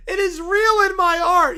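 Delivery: mock tearful wail. Melodramatic defence of holiday.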